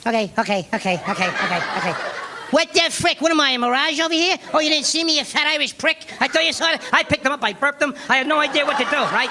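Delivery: hot-headed, pushy Pesci-style rant; short-fused mob brother-in-law; Joe Pesci. Retold or imitated. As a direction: rattle off like an angry little Pesci. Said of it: "like Joe Pesci running around".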